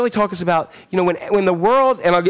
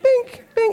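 mock beep. Imitating show's episode sound.

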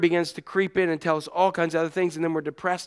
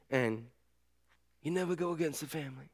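Godfather mobster line; 2 semitones lower, 14 dB quieter than usual. Retold or imitated. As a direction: say it like a quiet Godfather don.

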